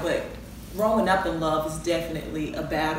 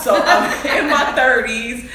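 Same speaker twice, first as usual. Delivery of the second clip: louder, slow, laughing through it; 5 semitones higher, 13 dB louder, slower than usual. Real, not acted. Introducing herself to guys, laughing slowly. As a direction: giggle through the age reveal.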